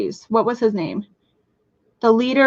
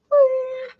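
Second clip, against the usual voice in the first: very high drawn-out whee; playful squeal; 14 semitones higher than usual. Real, not acted. Streamer greets Jen Jen, playful high squeal. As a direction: let out a high little wheee.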